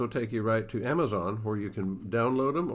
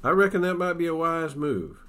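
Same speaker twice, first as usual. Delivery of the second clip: a hint of a drawling cowboy, raised and louder; frontier man's reply; 6 semitones higher, 7 dB louder than usual. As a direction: lightly voice the settler agreeing. Frank answers Wolfe in story.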